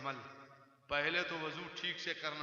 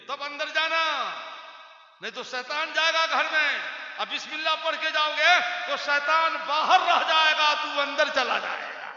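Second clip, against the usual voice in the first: high, loud, shouted sermon at full force; fiery preaching; 7 semitones higher, 12 dB louder than usual. Acted. Preacher shouting to the gathering to pray, at full force. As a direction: roar the call to prayer over the crowd.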